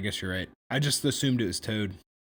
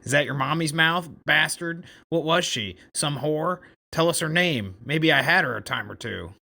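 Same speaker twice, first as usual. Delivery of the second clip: lightly voiced unpleasant bully, pitched higher; Toad; 4 semitones higher, 4 dB louder than usual. Toad taunts John about his mother, mildly unpleasant raised voice. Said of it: "with an unpleasant voice".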